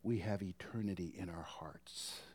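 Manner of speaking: scripture line dropped to a whisper; hushed reading of the verse. Acted. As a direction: let the verse fade into a whisper. Whispered end of scripture quote.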